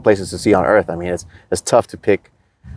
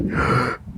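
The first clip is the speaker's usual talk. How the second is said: sharp gasp; 4 semitones higher, 8 dB quieter than usual. Retold or imitated. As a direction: gasp in to fill the lungs. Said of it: "gasp before shouting".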